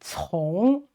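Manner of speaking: 从 with a rising pitch; second tone. Shown as a demonstration. Teacher showing the second tone, rising 从. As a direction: lift the pitch through 从. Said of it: "second tone".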